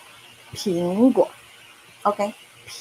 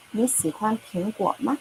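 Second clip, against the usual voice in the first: ordinary talk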